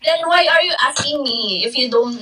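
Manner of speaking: ordinary talk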